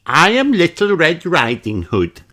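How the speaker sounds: high piglet voice; high, bright child character; little girl voice. A narrator's reading. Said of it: "she says".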